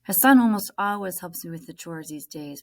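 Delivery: ordinary delivery, nothing marked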